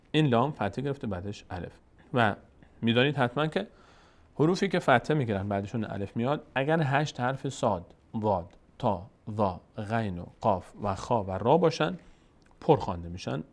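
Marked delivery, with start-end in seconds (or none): none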